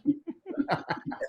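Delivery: high, breathy cartoon mouse laugh; Mickey Mouse laugh. Cartoon mouse laugh to close the coach's quote. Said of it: "using the Mickey Mouse voice".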